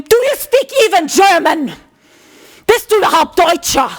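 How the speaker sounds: high, challenging mock outburst; imitated aggression. Retelling the man questioning Marcel's German, imitated aggression. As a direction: throw it out as an affronted demand. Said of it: "he was so aggressive".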